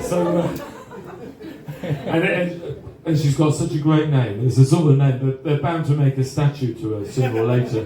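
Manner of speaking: ordinary talk, nothing marked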